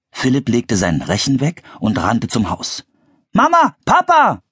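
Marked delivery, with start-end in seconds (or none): 3.3-4.4 put-on high, loud child's call. A narrator's reading. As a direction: call to the parents in the house. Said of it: "rief er". (hoch und laut rufend)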